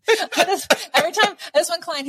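high, laughing lead-in; amused, voice lifted by laughter. Real, not acted. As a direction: talk through giggles. Laughing at client's excuse.

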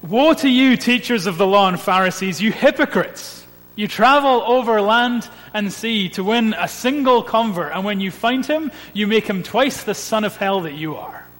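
loud and high, thundering condemnation; fiery rebuke. Retold or imitated. Preacher quoting Jesus rebuking the Pharisees, thundering rebuke. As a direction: denounce them at full volume like a prophet. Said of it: "You ready for a quote?".

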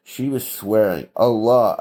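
ordinary talk, nothing marked

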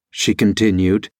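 usual speaking voice

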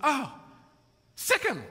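very high little parrot voice; high-pitched bird voice; parrot's command. Retold or imitated. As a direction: squawk the attack order like a parrot. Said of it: "the parrot stops and".

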